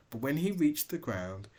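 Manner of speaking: ordinary delivery, nothing marked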